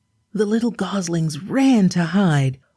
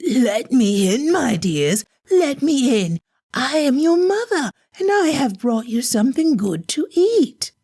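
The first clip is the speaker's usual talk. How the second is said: higher, sweeter mother imitation; wolf faking the mother's voice; wolf's put-on soft voice; 8 semitones higher than usual. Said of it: "he called in a soft voice".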